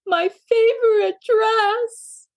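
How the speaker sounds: slow high wail. A narrator's reading. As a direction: draw out the wail, pitched high. Maisie wailing about dress.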